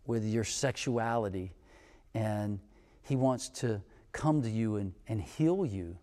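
soft, tender and careful, nothing pushy; gentle, sensitive words. Retold or imitated. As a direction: break hard news as gently as you can. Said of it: "being as sensitive as I possibly could".

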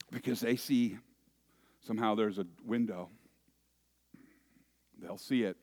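ordinary delivery

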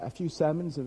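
ordinary talk, nothing marked

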